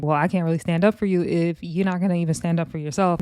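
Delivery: ordinary talk, nothing marked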